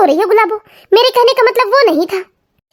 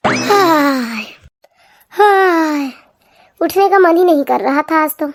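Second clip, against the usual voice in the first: drowsy, lower husband voice stretching awake; sleepy husband; 5 semitones lower, much slower than usual. Acted. Husband stretching awake, a lazy lowered voice. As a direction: yawn the words out lazily.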